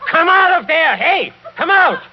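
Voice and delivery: loud, high commanding yell; shout. Acted. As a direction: bark it at someone hiding. Count shouting at hidden wife.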